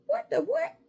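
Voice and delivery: high squeaky disbelief; incredulous squeal. Real, not acted. Mocking the other test-takers, a high incredulous squeal.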